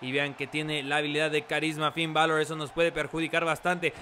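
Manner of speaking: normal talking voice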